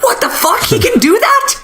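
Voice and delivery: high mock surprise; mock shock. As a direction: squeak it in cartoon astonishment. Characters shocked, retold.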